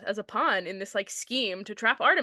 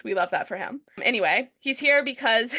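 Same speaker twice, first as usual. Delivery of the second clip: usual speaking voice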